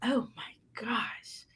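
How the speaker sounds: whispered, breathless imitation of fawning; mock gushing admirer. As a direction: whisper mock praise as if overcome with awe. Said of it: "that's all she doing is".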